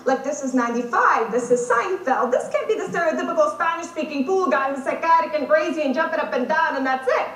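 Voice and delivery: normal talking voice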